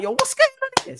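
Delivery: very high-pitched startled question; hammed-up shocked bystander; mock alarm. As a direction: imitate a crowd's alarm, pitch leaping up. Acting out everybody's alarmed reaction.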